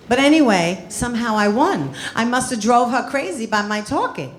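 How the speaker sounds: ordinary delivery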